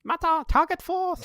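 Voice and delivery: very high cartoon heroine call; mock battle cry. Voicing a heroine transforming to save Hot Rod, mock battle cry. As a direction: call it out high, like an anime attack name.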